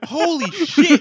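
laughing out the words, amazed; laughing exclamation. Real, not acted. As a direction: burst out laughing in disbelief. Amazed at shirtless photo.

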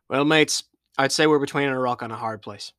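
wry joke to the crew; pirate voice. Acted.

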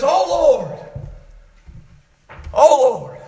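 loud, pleading preacher's cry; crying out to God. Retold or imitated. Preacher voicing David's cry to the Lord, crying out. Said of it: "he cries out and says".

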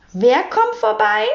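high, alert question; hellhörig. A narrator's reading. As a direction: prick up your ears and ask high. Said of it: "fragte Frau Rotkohl hellhörig". (hohe, hellhörige Nachfrage)

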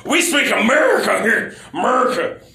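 loud, high hammy shout; mock patriotic shout. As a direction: bellow it like a proud patriot. Jokey patriotic outburst.

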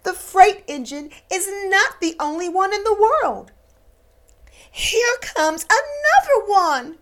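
a little clown pointing out a new engine; eager toy clown. Acted. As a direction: bounce with hope. Toy clown speaking hopefully.

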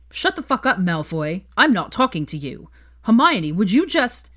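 high, loud furious outburst; angry shout. A narrator's reading. Ron yelling at Malfoy then Hermione. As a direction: cut him off hard.

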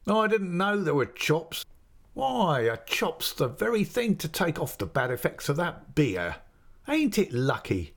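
put-on a friendly innkeeper's man coaxing the boy; chatty waiter. A narrator's reading. Waiter at the inn teasing the boy about the ale, lightly voiced.

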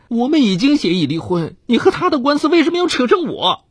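high, wounded complaint; plead, hurt and wronged; aggrieved. A narrator's reading. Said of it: "妻子委屈地说".

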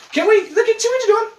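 high squeaky playful blame; high mock accusing. Playfully blaming cohost for a fart, high squeaky voice. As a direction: accuse her in a silly high voice.

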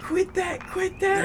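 very high falsetto protest; young lady voice. Retold or imitated. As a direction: squeal it like the startled woman. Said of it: "She's like".

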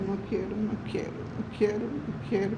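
soft, fading repeated plea; pleading to God. Retold or imitated. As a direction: murmur the refusal as if worn out. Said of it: "yo le pedía". (súplica suave y cansada)

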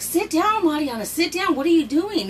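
high, loud scolding; mock stern order. Mother ordering her daughter to sit down, loud scolding.

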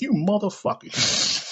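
slow, drawn-out high curse; Samuel Jackson impression. Vlogger turning into the actor to curse at trolls, slow and drawn out. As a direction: stretch out the insult like the actor. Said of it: "you just turn to Samuel Jackson".

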